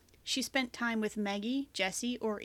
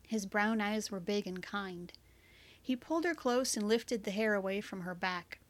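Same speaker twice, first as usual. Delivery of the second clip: plain reading voice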